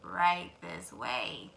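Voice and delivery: low, very slow fox; sly fox. Acted. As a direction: usher them in slyly. Fox leads them.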